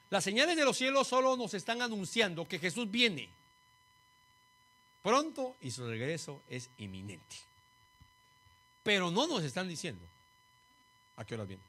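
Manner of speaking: loud, high proclamation; forceful preaching. Real, not acted. Preacher announcing to the congregation that Jesus is coming, forceful preaching. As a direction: ring it out to the whole congregation.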